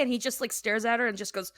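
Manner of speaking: usual speaking voice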